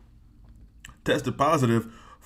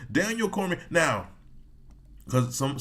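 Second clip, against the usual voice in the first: ordinary delivery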